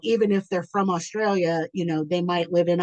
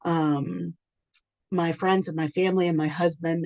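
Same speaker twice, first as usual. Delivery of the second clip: usual speaking voice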